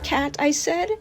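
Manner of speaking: usual speaking voice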